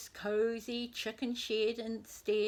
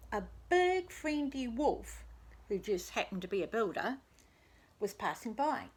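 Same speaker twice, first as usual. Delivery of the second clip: ordinary delivery, nothing marked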